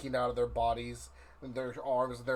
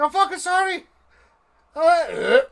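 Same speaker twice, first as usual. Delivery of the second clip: high, loud frantic shouting; panicked yell; 14 dB louder than usual. Acted. Gortek fleeing Mary's blows, yelling sorry. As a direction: yell it while ducking the book. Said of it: "He's like".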